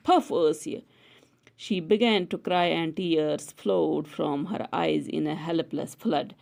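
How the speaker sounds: usual speaking voice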